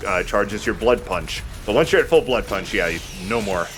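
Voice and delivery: normal talking voice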